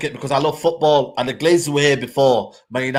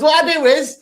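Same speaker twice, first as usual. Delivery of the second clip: put-on much higher voice of a young girl explaining; little girl voice; 8 semitones higher than usual. A little girl at the stadium explains her trick, high childlike voice. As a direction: play a seven-year-old proudly sharing a trick. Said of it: "she's about seven years old".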